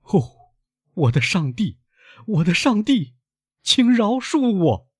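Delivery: anguished high-pitched plea; crying out. Acted. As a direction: beg forgiveness in a drawn-out high cry. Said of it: "埃德蒙大声说".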